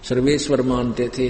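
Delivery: usual speaking voice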